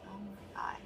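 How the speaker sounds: breathy whisper of disbelief. Real, not acted. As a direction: whisper it in awe. Reacting to chat numbers.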